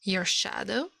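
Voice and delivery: plain reading voice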